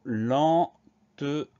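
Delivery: "lentement" slowly split into len-te-ment; very slow, separated syllables in "lentement"; sounded out syllable by syllable. Shown as a demonstration. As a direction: dictate "lentement" with a gap between syllables. Teacher sounds out word for dictation.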